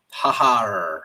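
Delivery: quick playful pirate "har"; pirate impression. Streamer teasing a viewer who likes silver, mock pirate voice. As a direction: throw in a jokey pirate growl-laugh. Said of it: "I have to do my pirate impression".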